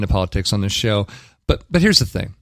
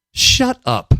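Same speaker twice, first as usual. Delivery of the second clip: high, louder exasperated outburst; 11 semitones higher, 4 dB louder than usual. Real, not acted. Host fed up with comedian playing the victim, exasperated. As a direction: pitch jumps up in disbelief.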